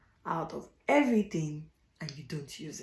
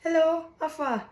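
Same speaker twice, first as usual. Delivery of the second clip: fake friendly greeting; 9 semitones higher than usual. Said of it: "And then you're like".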